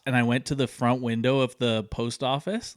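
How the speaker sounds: ordinary delivery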